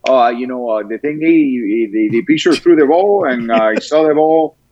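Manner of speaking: lightly put-on Dominican ballplayer accent, halting; mock Dominican player accent. Imitating a Dominican pitcher's interview, mild accent. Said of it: "they would just say things like".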